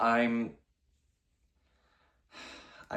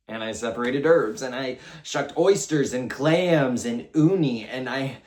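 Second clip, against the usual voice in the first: loud, raised-pitch listing; worked-up recounting; 4 semitones higher, 7 dB louder than usual. Acted. Cook recounts gruelling kitchen work, worked-up. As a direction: push each kitchen task out louder and higher.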